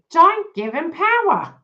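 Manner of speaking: high, loud and slow, every word pressed; emphatic urging. Real, not acted. Reader urging Dawn not to give her twin power, loud and emphatic. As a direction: warn her firmly, almost calling out.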